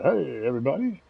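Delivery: a light touch of radio announcer. Pretend. Greeting Tiny's radio audience, mild radio host voice. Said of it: "I'll get my radio voice going there".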